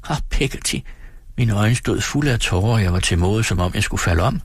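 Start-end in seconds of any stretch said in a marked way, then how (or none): 0.1-0.8 tearful plea. A narrator's reading. Tearful child pleads.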